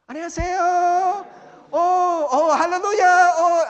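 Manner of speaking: loud, high, gushing church greeting; dad's over-the-top friendly hello to an elder; pretending nothing happened. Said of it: "pretend like nothing happened".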